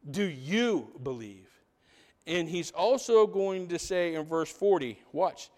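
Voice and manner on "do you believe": YOU drawn out, slow and lower; stress on YOU. Real, not acted. Preacher makes it personal to each listener, YOU stressed. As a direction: lean slowly on the word "you".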